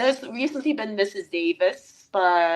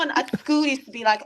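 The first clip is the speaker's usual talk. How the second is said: ordinary talk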